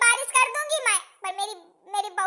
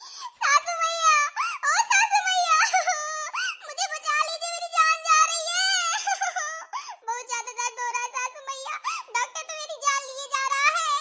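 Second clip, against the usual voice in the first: wailing in labour; 4 semitones higher than usual. Acted. Daughter-in-law in labour begs her mother-in-law, wailing. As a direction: wail for help through the pain. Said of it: "इतना तेज तेज घोटा फाड़ फाड़ चिल्ला रहा है".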